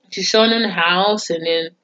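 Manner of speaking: usual speaking voice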